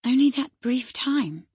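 stunned question. Acted. Catherine stunned by the date.

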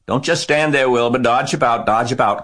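high, loud urgent commands; goose crying orders. A narrator's reading. Goose takes command as Wilbur is chased, crying out. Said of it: "cried the goose".

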